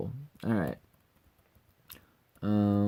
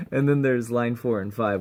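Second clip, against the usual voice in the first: usual speaking voice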